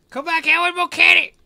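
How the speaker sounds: high, loud yell to stop the thief; shouting after him. Real, not acted. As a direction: holler after him to bring the cat back. Yelling at thief in game.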